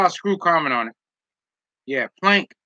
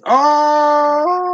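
surprised; 10 semitones higher, 4 dB louder than usual. Real, not acted. Reacting to a surprising comment.

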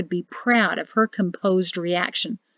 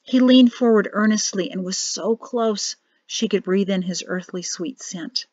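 ordinary delivery

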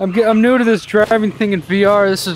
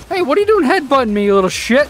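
higher, fast indignant yelp; indignant outburst; 6 semitones higher, faster than usual. Real, not acted. Teammate headbutting him, indignant fast outburst. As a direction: snap at him, pitched up and quick.

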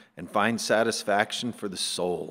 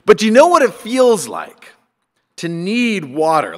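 higher, louder challenge to the congregation; raised pulpit voice; preaching emphasis; 7 semitones higher, 11 dB louder than usual. Real, not acted. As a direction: press the question home with force. Preacher's rising rhetorical question.

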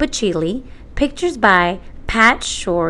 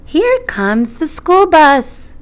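put-on reading to a toddler; 8 semitones higher, 5 dB louder than usual. Reads picture book to child.

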